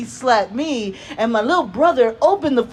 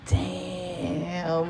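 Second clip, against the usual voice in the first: long drawn-out, softer "damn"; impressed aside; 2 semitones lower, 7 dB quieter than usual. Retold or imitated. Daughter silently reacting to mom's jab at dad, impressed. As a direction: stretch it out under your breath.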